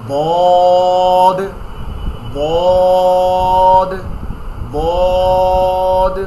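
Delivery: cup the tongue and make বদ heavy; heavy dad. Shown as a demonstration. Teacher showing students the thick letter dad. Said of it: "মোটা করে".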